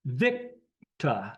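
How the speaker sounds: Victor said as VIK, tah, no r; shown pronunciation. Shown as a demonstration. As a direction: sound out "Victor" in two parts ending in tah. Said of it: "not Victor".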